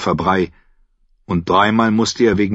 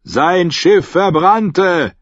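higher and louder, hands cupped round the mouth; calling up; 10 semitones higher, 5 dB louder, much slower than usual. Acted. Davos calls up to the guard on the wall. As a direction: call up to the gate.

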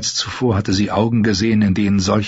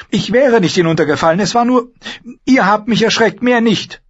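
put-on higher, fast girl's voice, defensive; young lady insisting she wasn't scared, pitched up; frightened Sansa; 12 semitones higher, 4 dB louder than usual. Sansa denying her fear to the Hound, high and quick.

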